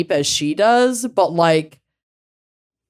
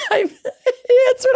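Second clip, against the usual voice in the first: laughing; slower than usual. Real, not acted. Laughing while telling.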